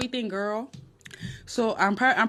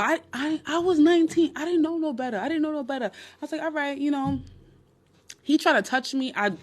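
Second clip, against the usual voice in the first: higher, pleading excuse; I was nineteen, higher pleading; defensive; 7 semitones higher than usual. Real, not acted. Explaining to viewers she was young, higher pleading.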